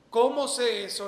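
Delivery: emphatic question. Acted. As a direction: throw the question out to the room. Rhetorical question repeated quietly after. (pregunta fuerte y enfática)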